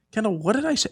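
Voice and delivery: very high, incredulous mock question; baffled questioning. Dad asking his kid, bewildered.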